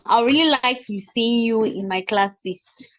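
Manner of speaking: normal talking voice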